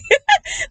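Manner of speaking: loud, high-pitched laugh; high burst of exasperated laughter. Real, not acted. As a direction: laugh in disbelief mid-rant. Laughs mid-rant in exasperation.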